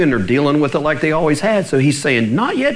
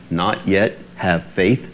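literal word by word; 9 semitones lower, 3 dB quieter, slower than usual. Shown as a demonstration. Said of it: "Sound like a robot".

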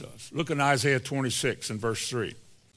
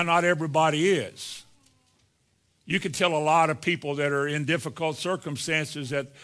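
ordinary delivery

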